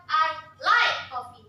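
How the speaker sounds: like stressed loud; "like" punched. Shown as a demonstration. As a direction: hit the word like. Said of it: "How about".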